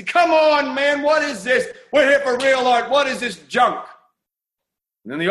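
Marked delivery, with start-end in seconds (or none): 0.0-3.8 much higher, louder jeering crowd voices; outraged crowd. Acted. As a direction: scoff like an indignant art crowd. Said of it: "And the crowd goes crazy".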